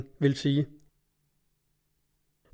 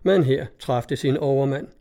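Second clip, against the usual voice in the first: ordinary delivery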